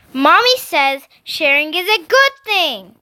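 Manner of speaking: put-on high, loud little-girl shout. A narrator's reading. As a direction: shout it back like a bratty child. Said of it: "Brianna shouted".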